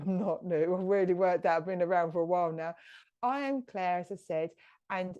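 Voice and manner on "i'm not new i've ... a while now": laughing. Real, not acted. Laughs at own slip, keeps talking.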